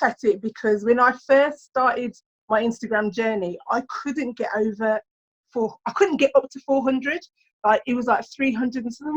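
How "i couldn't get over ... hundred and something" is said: usual speaking voice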